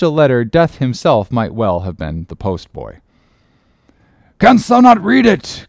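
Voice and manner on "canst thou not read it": high, loud, demanding question; impatient cry. A narrator's reading. Ahab demanding that the letter be read, high loud cry. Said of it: "cried Ahab".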